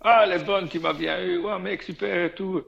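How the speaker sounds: high-pitched amused exclamation; mock-delighted, pitched right up; imitated amusement. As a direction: react as if you've been pranked. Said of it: "il commence à rigoler".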